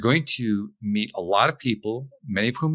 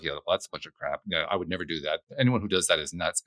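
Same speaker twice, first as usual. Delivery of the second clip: low, softer mock dismissal; dismissive inner voice; 6 semitones lower, 3 dB quieter than usual. Guest voicing what you say to yourself, dismissively. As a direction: brush it off under your breath, pitched down. Acts out dismissive thoughts to self.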